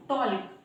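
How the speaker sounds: टॉल्क with the L sounded before K; wrong pronunciation. Shown as a demonstration. Teacher listing mistakes for learners, L voiced in टॉल्क. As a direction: voice the silent L in टॉल्क. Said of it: "इस तरह से प्रोनाउंसिएट करना गलत".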